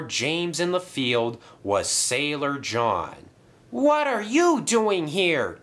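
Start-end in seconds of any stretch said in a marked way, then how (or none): 3.7-5.6 high, drawn-out startled question; shocked gasp. A narrator's reading. James finding Sailor John before him, gasping. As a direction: ask it high and slow, taken aback. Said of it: "gasped James".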